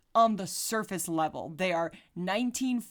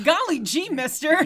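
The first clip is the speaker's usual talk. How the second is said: put-on wholesome 1950s kid; 8 semitones higher, 7 dB louder than usual. Mimics fifties sitcom kid, laughing.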